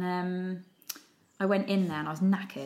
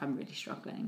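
hushed confession; 5 semitones lower, 10 dB quieter than usual. Retold or imitated. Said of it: "she's like".

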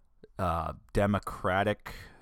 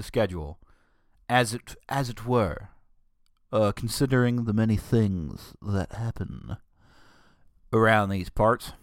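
southern. Real, not acted. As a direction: slip into a southern twang. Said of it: "that sounded really southern".

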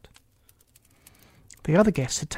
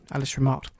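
ordinary delivery

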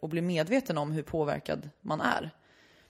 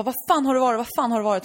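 furious father; 5 semitones higher, 5 dB louder, faster than usual. Retold or imitated. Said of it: "Och pappan bara".

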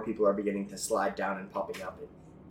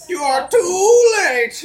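high, loud, slow, laughing villain; unhinged villain; 15 dB louder, much slower than usual. Acted. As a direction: gloat slowly through the cackle. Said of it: "maniacal laughing".

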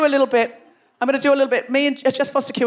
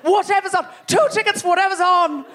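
high, shouted box-office request; eager ticket buyer; 6 semitones higher than usual. Retold or imitated. Comic voices tourists buying tickets, high blithe call. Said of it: "you've said".

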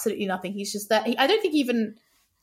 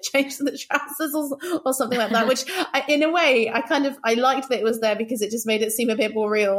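ordinary talk

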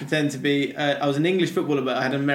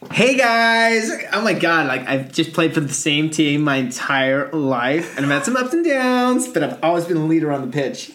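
a lightly camp American footballer voice; American accent; 2 semitones higher, 4 dB louder than usual. Pretend. Host impersonating footballer for a guessing game, American accent. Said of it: "doing it in an American accent".

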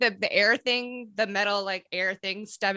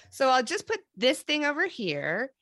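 ordinary delivery

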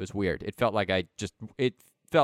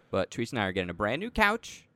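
usual speaking voice

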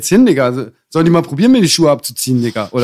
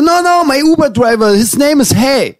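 imitated stranger; 9 semitones higher than usual. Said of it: "Und er so".

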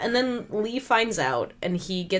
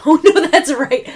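ordinary talk

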